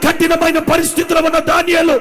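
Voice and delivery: loud, high fervent preaching cry; preaching at full force. Acted. Preacher to his congregation, shouting with full fervour. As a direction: rouse the congregation with rising shouts. Preaching Daniel story loudly.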